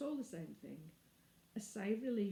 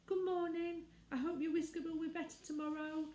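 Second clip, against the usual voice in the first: put-on high, timid girl's call over her shoulder; a small girl calling back nervously while running; timid shout; 9 semitones higher than usual. Girl fleeing the sand fairy, timid high call. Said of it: "shout a timid".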